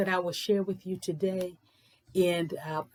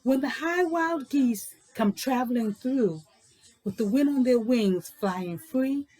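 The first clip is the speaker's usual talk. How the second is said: louder, measured storybook reading; read-aloud story narration; 4 dB louder than usual. A narrator's reading. Teacher reading a picture book aloud to young kids, louder storytelling voice.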